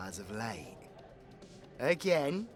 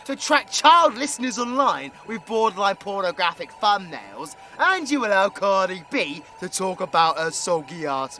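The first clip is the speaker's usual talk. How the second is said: loud, raised-pitch tirade; indignant rant; 5 semitones higher, 7 dB louder than usual. Acted. Ranting at YouTube over its double standards, indignant. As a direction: argue back loudly, piling up complaints.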